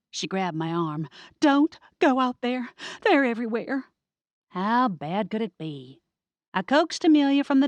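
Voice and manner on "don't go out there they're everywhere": higher, louder frantic plea; frightened plea. Acted. Amelia grabbing her cousin's arm, pleading in fright, higher and louder. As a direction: beg her to stay inside.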